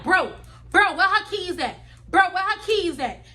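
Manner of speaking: loud, pushy demand for the keys; retelling a friend yelling at the thief. Retold or imitated. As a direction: lean on him, loud and aggressive.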